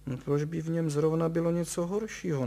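baffled that anyone would think so; puzzled objection. A narrator's reading. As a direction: object in wonder, pitch lifted. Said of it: "namítal pan Horvát s podivem".

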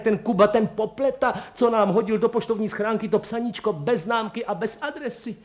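high, exuberant torrent of mock insults; joyful outcry. A narrator's reading. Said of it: "vykřikl pan Kolbaba radostně".

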